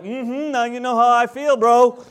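smug God. Retold or imitated. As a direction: say it with a knowing smirk. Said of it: "so God's like".

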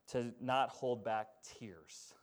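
slow, weighty point; slow emphasis. Real, not acted. Preacher stating his last point, slow and weighty. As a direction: drag each word to land it. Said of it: "that's my last point".

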